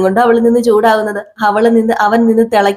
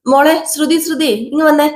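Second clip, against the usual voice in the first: higher voice calling Shruthi over; 7 semitones higher than usual. Acted. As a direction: call her in from the next room. Chandra calls Shruthi from afar. (ശ്രുതിയെ വിളിക്കുന്ന അമ്മായിയമ്മ, ഉയർന്ന ശബ്ദത്തിൽ)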